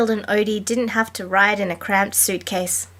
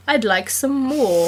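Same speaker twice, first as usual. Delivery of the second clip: put-on mild British accent, meek request; a touch of British beggar-boy plea; 3 semitones higher than usual. Garfield holds up his plate, mildly British plea. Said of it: "he said in a British accent".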